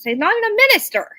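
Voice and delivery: quick, high exclamation; excited outburst. Real, not acted. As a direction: blurt it out fast and high. Indignant punchline about Prime Minister.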